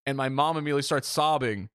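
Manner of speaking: normal talking voice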